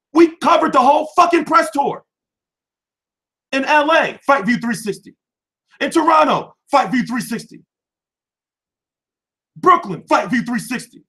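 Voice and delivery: high, loud proud listing; rising pride, city after city; proud boast. Real, not acted. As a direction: brag about every city. Boasting of press tour coverage.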